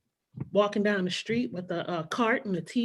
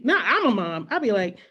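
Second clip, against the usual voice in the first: ordinary talk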